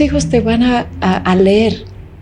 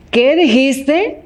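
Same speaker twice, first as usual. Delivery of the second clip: baffled; 4 semitones higher, much slower than usual. Pretend. Partner stunned by calm reply.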